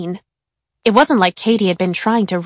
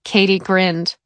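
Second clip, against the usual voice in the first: plain reading voice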